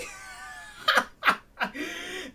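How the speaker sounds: high, loud burst of laughter; real laugh. Real, not acted. Player laughing at a botched accent attempt, high laughter. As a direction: crack up at your own accent. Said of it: "it's actually harder than I thought".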